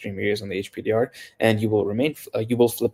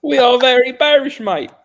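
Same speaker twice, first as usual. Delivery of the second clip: hammed-up British accent, much higher and louder; mock British voice, pitched way up; bad British accent; 11 dB louder than usual. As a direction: play a terrible cockney for laughs. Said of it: "the worst British accent anyone can find online".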